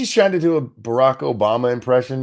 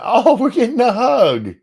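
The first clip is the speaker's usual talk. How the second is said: mock delight; 13 semitones higher, 8 dB louder than usual. Sarcastic joke about the pause.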